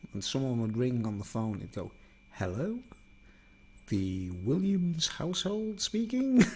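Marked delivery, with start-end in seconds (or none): 2.3-6.4 high, slow mock telephone voice; telephone manner. Mum answering the house phone, put-on telephone manner. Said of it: "used to have a telephone manner".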